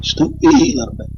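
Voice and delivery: a tired voice breaking into a high squeak; voice going. Real, not acted. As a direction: voice cracking up high. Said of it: "my voice is starting to go".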